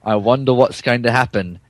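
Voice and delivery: normal talking voice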